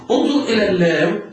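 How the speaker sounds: ordinary talk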